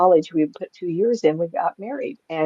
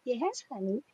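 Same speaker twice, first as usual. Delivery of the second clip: cooing aside; 6 semitones higher, 12 dB quieter than usual. Real, not acted. Speaker breaks off to comfort a pet, cooing. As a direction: soothe the pet, high and soft.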